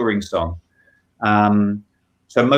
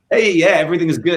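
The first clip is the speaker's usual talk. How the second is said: breezy cheerful; 7 semitones higher, 4 dB louder, faster than usual. Retold or imitated. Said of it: "none of them are just this sort of like".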